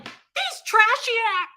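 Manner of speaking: high loud sneering slur; loud scornful. Retold or imitated. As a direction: spit it with contempt. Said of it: "I was like".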